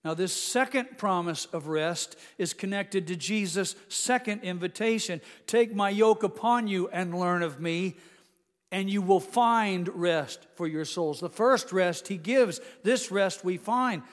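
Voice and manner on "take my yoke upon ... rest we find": louder, higher pulpit appeal; fervent exhortation. Acted. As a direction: press the point home. Preacher's closing exhortation.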